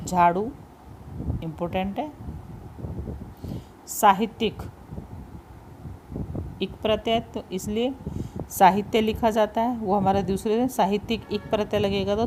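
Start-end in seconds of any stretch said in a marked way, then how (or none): none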